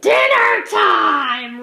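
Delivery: huge, slow, stretched roar; roaring wolf. A narrator's reading. Wolf roaring as he leaps at the chickens. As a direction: roar it out as you pounce. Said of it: "roared Mister Wolf".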